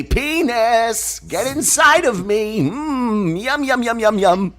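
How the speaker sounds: high, slow mock seductive purr; mock lusty voice. Mocking what Jerry Lewis does not say, high hammed-up lust. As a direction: ham up a lusty coo. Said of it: "He's not saying".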